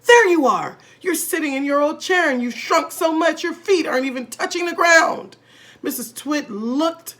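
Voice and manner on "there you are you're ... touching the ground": higher, louder triumphant shout. A narrator's reading. Husband shouting at seated wife. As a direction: point and shout gleefully. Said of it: "pointed at her and shouted".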